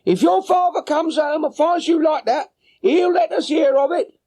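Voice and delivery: high, mock shout of a red-faced woman calling her boy; shouting mother. Mother scolding her little son, high shouting woman's voice. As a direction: call the runaway child back with a high scolding woman's voice.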